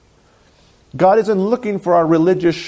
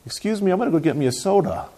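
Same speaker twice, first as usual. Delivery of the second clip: quick, soft, higher-pitched excuse; polite excuse; 4 semitones higher, 5 dB quieter, faster than usual. Acted. Churchgoer leaving coworkers for the soda machine, politely. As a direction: slip away politely with a light, hurried word. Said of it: "You politely say".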